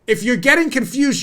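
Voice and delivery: usual speaking voice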